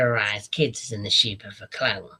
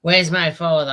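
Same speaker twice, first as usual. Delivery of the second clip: louder, higher mock demand; half-acted demand for the father; retold demand; 5 semitones higher, 8 dB louder than usual. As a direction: shout the question up high. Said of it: "He goes".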